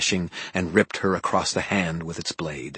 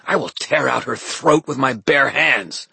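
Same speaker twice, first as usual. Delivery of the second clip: menacing threat; 6 semitones higher, 4 dB louder than usual. A narrator's reading. Villain threatens.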